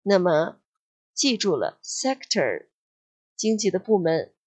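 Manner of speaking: ordinary delivery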